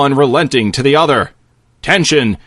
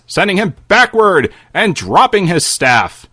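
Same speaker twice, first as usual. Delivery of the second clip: ordinary talk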